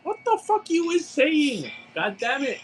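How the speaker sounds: high-pitched, exasperated wail; exasperated outburst. Real, not acted. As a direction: throw up your voice high in baffled exasperation. Exasperated at caller's confusing story.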